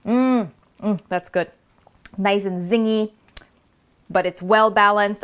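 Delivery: normal talking voice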